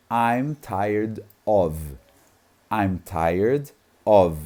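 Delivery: very slow, "I'm tired of" pulled apart word by word; slow English drill with pauses. Shown as a demonstration. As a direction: spell out "I'm tired of" slowly, one word at a time. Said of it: "falei cada uma delas separadamente".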